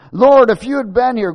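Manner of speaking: high, loud, dramatic lament; Martha's outcry. Acted. Martha reproaching Jesus for coming late, loud lament. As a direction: wail it loudly at the Lord. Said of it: "Now this was Martha".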